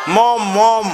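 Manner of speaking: lightly voiced children's chant; mock kids calling out; children answering. Preacher voicing kids shouting mom at him. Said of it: "जोरात बोला".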